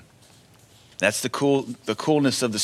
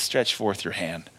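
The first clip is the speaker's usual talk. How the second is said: quiet command; 5 semitones lower, 6 dB quieter, slower than usual. Acted. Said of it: "Jesus just looks and says".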